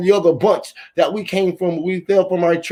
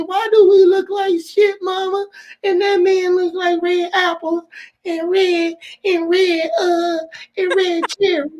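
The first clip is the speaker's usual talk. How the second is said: put-on very high, slow little-boy voice; high child voice; 12 semitones higher, slower than usual. Little boy asking his mama why, high and slow. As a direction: whine it up high like a small boy.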